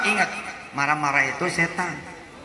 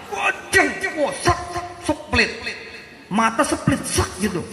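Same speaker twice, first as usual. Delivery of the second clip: mock angry outburst; 9 semitones higher, 4 dB quieter than usual.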